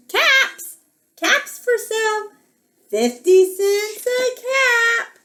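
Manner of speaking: high, drawn-out hawker's cry; singsong storybook peddler cry; street vendor's call. A narrator's reading. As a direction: call out wares to the whole town. Peddler calls out his wares.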